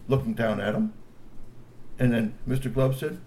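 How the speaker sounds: usual speaking voice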